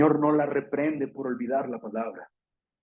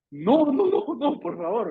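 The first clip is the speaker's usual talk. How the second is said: high whiny no no, por favor; begging child; 6 semitones higher, slower than usual. Pretend. Child begging an angry parent: high whiny plea. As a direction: plead with a parent not to punish.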